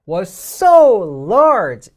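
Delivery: so punched very loud and slow; stress on SO. Shown as a demonstration. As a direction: hit so hard in was so large. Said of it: "이렇게 이야기가 시작이 되거든요".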